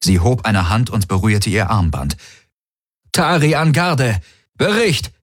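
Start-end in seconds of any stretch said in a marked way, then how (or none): 3.1-5.1 high, urgent radio call; urgent command. Acted. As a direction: bark the order into the comm. (dringender Funkruf)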